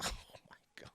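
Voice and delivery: hushed, voiceless disbelief; real whispered amazement; stunned whisper. Real, not acted. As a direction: whisper it under your breath in disbelief. Stunned reaction to viral clip.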